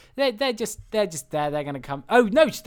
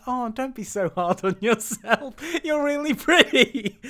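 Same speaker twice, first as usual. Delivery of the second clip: high, slow, laughing cat voice; high-pitched coo breaking with laughter; cat's reassurance; 9 semitones higher, 5 dB louder, slower than usual. Retold or imitated. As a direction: imitate a flattering cat, pitched up and drawn out. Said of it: "And the cat goes".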